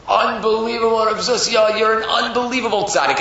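high, excited praise; gushing flattery. Acted. Yetzer Hara puffing Zusha up, gushing. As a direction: marvel at him extravagantly.

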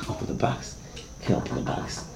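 hushed, breathless plea; scared smuggler whispering to the guard; frightened whisper. Acted. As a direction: whisper it in panic.

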